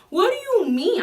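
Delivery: high, loud what do you mean; outraged disbelief at the teacher; incredulous outburst. Retold or imitated. Outraged retold reaction.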